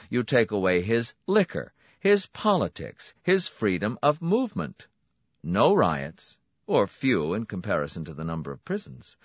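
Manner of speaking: a smooth salesman pleased with himself; brisk, confident host welcoming a client; Donati's voice. A narrator's reading. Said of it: "slammed his fist for emphasis".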